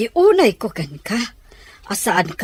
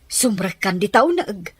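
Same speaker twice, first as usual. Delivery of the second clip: ordinary talk, nothing marked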